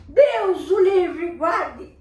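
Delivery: loud, slow, high revolted yell; angry father fleeing the bad breath, yelling; disgusted shout. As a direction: recoil from the stench shouting. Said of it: "O homem".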